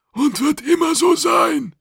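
very high, loud creature voice; stubborn alien official. A narrator's reading. Kidang backing up his colleagues, high stubborn shout. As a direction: pipe it up loudly and stubbornly.

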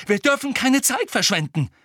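high, agitated exclamation; urgent cry. A narrator's reading. Harry pressing to leave at once, urgent cry. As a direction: call out with urgency. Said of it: "rief Harry".

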